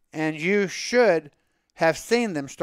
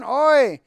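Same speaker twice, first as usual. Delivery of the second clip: oi exclamation; 6 semitones higher, 6 dB louder than usual. Acted. Exclamation of dismay.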